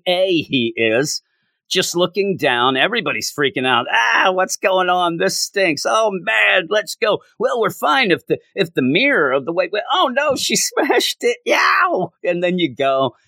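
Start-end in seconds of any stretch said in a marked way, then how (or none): 3.9-11.4 high, hammed-up panicked chatter; mock panic. Reviewer acting out the panicking heroes, high and hammed-up. 11.5-12.1 very high shouted yelp; cartoon yelp. Heroes panicking at the bells.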